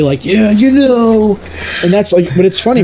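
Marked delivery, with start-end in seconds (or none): 0.2-1.4 lounge back and drawl it out smugly; lazy radio host. Retold or imitated. Mocking the radio guy sipping coffee in boxers, slow and high. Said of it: "he's in his boxers drinking, like, a Kahlua and coffee".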